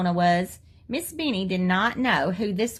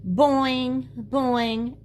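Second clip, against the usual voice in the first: teasing boing; 5 semitones higher than usual. A narrator's reading. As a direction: tease her with it.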